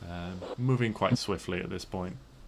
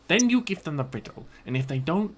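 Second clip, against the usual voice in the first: higher, louder goblin scolding; 5 semitones higher, 4 dB louder than usual. Acted. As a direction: nag the troll in a high goblin voice. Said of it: "It's like".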